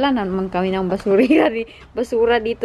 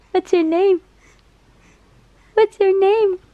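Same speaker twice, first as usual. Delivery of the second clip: high-pitched coaxing question; 7 semitones higher than usual. Real, not acted. Coaxing baby to respond.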